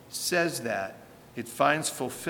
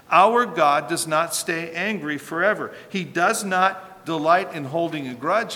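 rising, louder preaching build; fervent preaching; 3 semitones higher, 6 dB louder than usual. Acted. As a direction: drive the point home to the congregation. Preaching at full force again.

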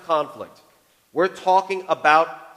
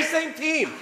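high, slow teasing demand through a laugh; playful insistence; 12 semitones higher than usual. Retold or imitated. Husband pushing wife to say it back, teasing. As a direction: pester her with a grin. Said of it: "And I'm like".

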